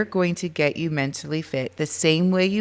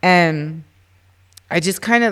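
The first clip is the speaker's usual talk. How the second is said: usual speaking voice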